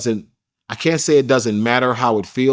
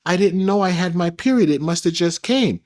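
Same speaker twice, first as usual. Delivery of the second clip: higher, put-on innocent girl voice; mocking her fake innocence; 7 semitones higher than usual. Imitating the woman playing innocent about her period. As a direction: mimic her pretending surprise. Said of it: "she pretends like".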